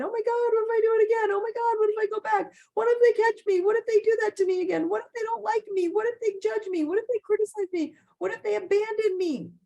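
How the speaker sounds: high, fast, panicky what-ifs; anxious inner voice. Acted. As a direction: spiral into racing worry, voice shooting up. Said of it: "What fears keep ruminating in my mind".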